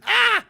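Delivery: high, loud burst of overwhelm; mock breakdown yell; sudden scream. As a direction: cry out as it all breaks. Breaking point, outburst.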